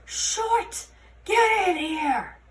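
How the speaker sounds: high, loud, slow bellow; bellowing commander. A narrator's reading. Commander Root yelling for Holly from his office. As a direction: roar her name down the hall.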